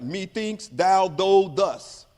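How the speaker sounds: slow stumbling read of Shakespeare, one word at a time; a kid sounding out old English; halting reading. Retold or imitated. Fifth grader stumbling through Shakespeare aloud, slow and halting. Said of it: "And I was reading".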